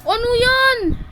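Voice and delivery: high loud what is that; startled. Real, not acted. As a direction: shock at what just hit you. Startled by opponent.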